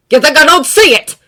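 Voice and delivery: loud, high bark. A narrator's reading. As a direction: bark the question. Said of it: "Bakugo barks out".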